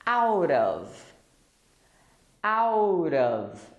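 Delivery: "Out of" run together as OW-duv, drawn out; linked words. Shown as a demonstration. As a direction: glide "Out of" into one word, flapped T. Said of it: "I just want to stress the connection here".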